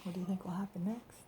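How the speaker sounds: hushed, secretive question; soft suspenseful whisper. Shown as a demonstration. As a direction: lean in and whisper to the child. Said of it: "aside to listener".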